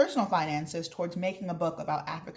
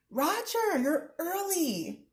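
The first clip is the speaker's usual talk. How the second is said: surprised greeting; 12 semitones higher, much slower than usual. Acted. Mama greets worker.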